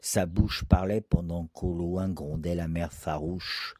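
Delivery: ordinary delivery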